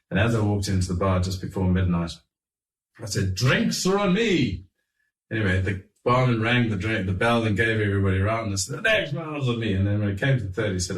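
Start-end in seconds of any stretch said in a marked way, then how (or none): 3.3-4.7 high, slow, drawn-out announcement; calling out. Retold or imitated. Walking into the hotel bar, announcing a round grandly. 8.8-9.7 high-pitched mock announcement; retold calling out. Round announced to everybody in the bar, calling out.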